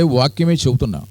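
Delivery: ordinary talk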